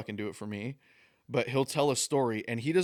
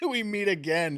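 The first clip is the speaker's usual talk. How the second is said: higher, amused echo of the delivery guy; retold greeting, pitched up in disbelief; incredulous requote; 6 semitones higher than usual. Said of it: "This dude just said".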